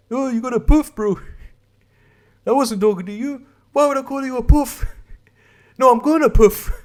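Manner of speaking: high-pitched mock Kiwi accent; caricatured New Zealand Maori voice. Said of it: "I think it's Kiwi. Like Maori".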